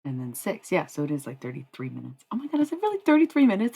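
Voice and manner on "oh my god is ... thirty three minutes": much higher, louder and fast, thrilled; excited surprise. Real, not acted. Realizing her mixtape runs thirty-three minutes, thrilled surprise. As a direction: burst out in delighted disbelief.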